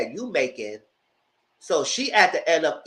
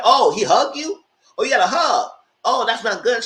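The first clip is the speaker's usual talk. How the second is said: mock jealous man; 7 semitones higher, 6 dB louder than usual. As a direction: ham up his sneering jealousy, pitched high. Jealous man mocked in retelling.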